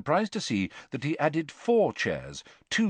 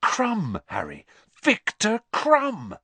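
starstruck; 11 semitones higher, 3 dB louder, slower than usual. Acted. Starstruck boy spots his idol.

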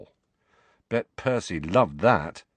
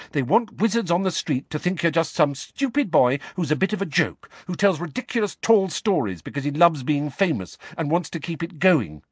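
put-on much higher pleading girl's voice; Hermione, urgent; 10 semitones higher than usual. A narrator's reading. Hermione urges Harry to understand, high and urgent. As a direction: Hermione making him see.

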